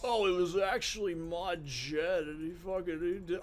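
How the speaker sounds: put-on voice of a conspiracy theorist; lightly mock someone pushing a conspiracy; mocking conspiracy believer. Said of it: "there's some crazy conspiracy".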